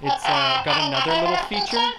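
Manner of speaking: high squeaky elf voice; elf voice gizmo. Real, not acted. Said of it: "make me sound like an elf?".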